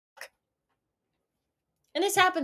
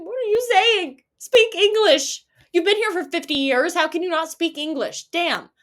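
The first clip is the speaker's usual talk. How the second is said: louder and higher, laughing in disbelief; exasperated outburst; 6 semitones higher, 6 dB louder than usual. Retold or imitated. Customer venting at her nail technician, louder and higher exasperation.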